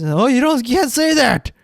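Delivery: put-on scolding kid voice. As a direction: nag like a prissy kid telling you off. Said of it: "most kids".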